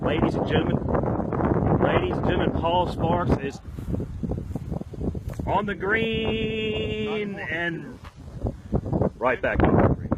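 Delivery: hammed-up sports announcer; announcer hype. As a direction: stretch it out like a stadium announcer. Mock announcer calling shot.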